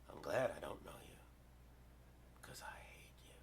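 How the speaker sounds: voiceless whisper full of contempt; whispered hatred in the trench coat man's voice; hateful whisper. Retold or imitated. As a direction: hiss it quietly, with contempt.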